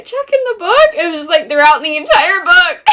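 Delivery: high, loud, laughing through the words. Real, not acted. Telling a friend a funny book mix-up, laughing. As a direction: break up laughing as you tell it.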